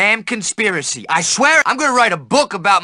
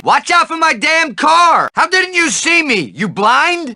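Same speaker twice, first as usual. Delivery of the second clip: angry shout; 5 semitones higher than usual. Acted. Driver yelling at a kid near his car, angry shout. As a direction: bark it at the reckless kid.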